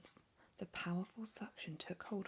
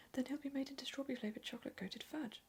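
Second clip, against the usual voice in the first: higher, fast, frantic whisper; whispered scream; 7 semitones higher, faster than usual. Acted. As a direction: rush it out in horror, hushed. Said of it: "screamed Missus Gloop".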